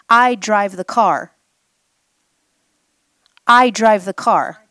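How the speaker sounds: loud and slow, leaning on I; firm declaration. Shown as a demonstration. Teacher declares to the group who drives the car. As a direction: plant each word like a statement of authority. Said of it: "emphatic teaching point".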